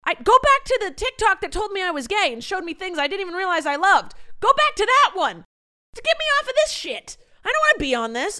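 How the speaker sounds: frustrated yelling. Real, not acted. Pleading with TikTok to fix her feed, loud and high. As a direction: shout your protest at the app.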